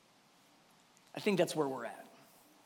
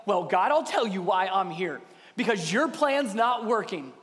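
indignant complaint, climbing higher; Elijah; 4 semitones higher than usual. Acted. Elijah blaming God's plan, increasingly indignant. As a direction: build the grievance, stressing who is to blame. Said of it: "Do you hear that in Elijah's voice".

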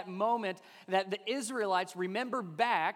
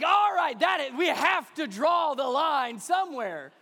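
loud, high exasperated protest; hammed-up fed-up husband; loud mock outburst; 7 semitones higher, 8 dB louder than usual. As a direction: put his foot down, loud and comic. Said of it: "I'm like".

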